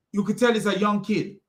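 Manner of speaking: ordinary talk, nothing marked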